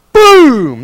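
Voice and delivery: loud, high mock explosion; explosive boom. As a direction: blast it out like a bomb. Imitating a bomb.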